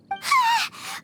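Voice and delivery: high, fearful whimpering gasp; a scared little cry; frightened sob of a gasp; panicked whimper. Acted. Paimon fears losing limbs.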